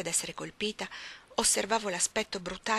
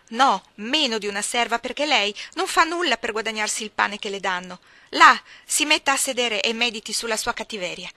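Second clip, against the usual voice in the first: contemptuous maid; 3 semitones higher, 9 dB louder than usual. Acted. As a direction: put her in her place. Maid belittles Jane. (cameriera sprezzante, forte)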